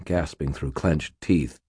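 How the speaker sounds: usual speaking voice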